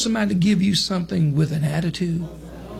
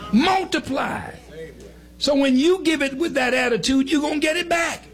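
preaching; 7 semitones higher than usual. Acted. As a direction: build the sermon to a shout. Preaching builds to a climax.

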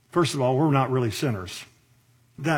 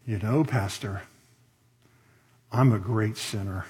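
very spiritual tone; 6 semitones lower, 4 dB quieter than usual. Acted. Lady humbly confessing to her preacher, very spiritual tone. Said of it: "in a very spiritual tone".

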